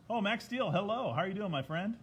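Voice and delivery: high, loud happy hello; excited greeting. Real, not acted. Greeting a viewer who joined.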